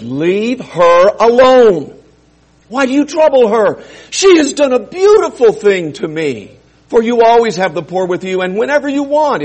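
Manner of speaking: Jesus scolding the disciples, firm and loud; Jesus rebuking. Acted. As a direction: defend her firmly.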